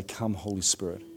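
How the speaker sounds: soft, hushed invocation; quiet prayer. Retold or imitated. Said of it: "and you say".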